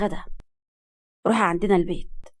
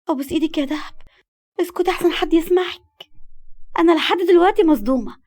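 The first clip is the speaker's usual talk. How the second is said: high, pleading and flustered; urgent pleading; 7 semitones higher than usual. A narrator's reading. Girl begs shrieking friend for silence. (ترجّي بلهفة)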